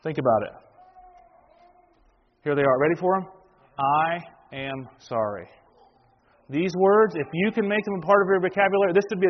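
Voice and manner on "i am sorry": each word spaced out, slightly low and soft; slow, deliberate. Shown as a demonstration. Preacher revealing three life-changing words, slow and deliberate. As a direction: lay the words down one at a time.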